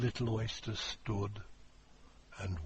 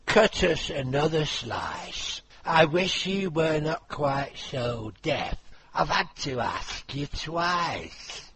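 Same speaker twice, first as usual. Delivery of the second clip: carpenter voice; 5 semitones higher, 5 dB louder than usual. Acted. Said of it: "The carpenter said nothing but".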